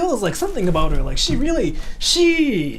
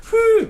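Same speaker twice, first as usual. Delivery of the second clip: playful hoo; 6 dB louder than usual. Retold or imitated. Childish sound while messing with her.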